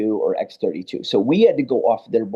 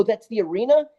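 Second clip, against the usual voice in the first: higher-pitched, incredulous question; retold disbelief; 8 semitones higher than usual. Engineer realizing the reverb is the room, incredulous. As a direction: lift "arena" into a surprised question.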